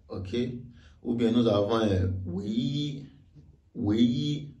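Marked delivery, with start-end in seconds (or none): 2.3-3.0 "wei yi": "wei" rising to a high level "yi"; first-tone yi. Shown as a demonstration. Teacher shows unchanged yi, high level in "wei yi". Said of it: "le i est lu comme tel". 3.7-4.5 "wei yi": "wei" rising to a high level "yi"; first-tone yi. Shown as a demonstration. Teacher shows unchanged yi, high level in "wei yi". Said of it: "le i est lu comme tel".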